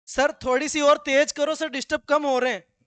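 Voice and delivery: hammed-up student asking the teacher; mock student plea. Student asks teacher to speed up.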